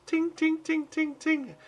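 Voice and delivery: high ringing "ting, ting" like a hammer on an anvil; hammer clang imitation. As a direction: ring out each ting like metal struck.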